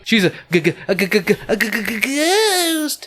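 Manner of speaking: hammed-up cartoon fright; terrified stammer. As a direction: stutter climbing to a high wail. Narrator hams up stammered fright.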